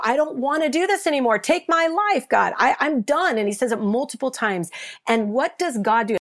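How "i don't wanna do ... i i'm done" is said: high, loud despairing cry; retold scream. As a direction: cry out like a man at the end of his rope. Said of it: "screams out".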